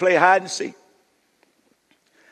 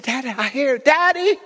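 daughter calling; 5 semitones higher than usual. Retold or imitated. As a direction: start high, then cry out for Daddy. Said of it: "She said".

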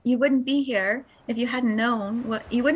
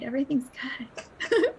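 whisper. Real, not acted. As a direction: whisper it gently, like calming someone. Soothing reassurance in a meditation.

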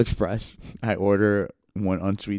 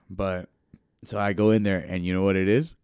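ordinary talk, nothing marked

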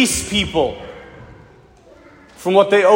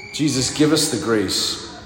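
low, subdued prayer voice; closing prayer; 9 semitones lower, 5 dB quieter than usual. Real, not acted. Preacher asking Jesus for a merciful heart, prayerful. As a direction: lead them gently into prayer, pitched low.

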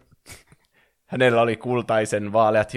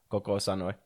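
ordinary delivery

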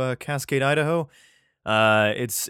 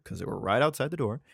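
usual speaking voice

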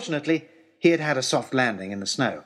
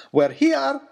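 higher and louder, gleeful cry; delighted shout; 12 semitones higher, 5 dB louder than usual. A narrator's reading. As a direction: shout it out, thrilled to arrive. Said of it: "he shouted to us".

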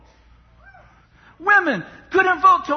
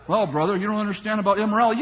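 mock objector; 6 semitones lower, faster than usual. Preacher voicing a critic who says he does not understand immorality, lower and fast.

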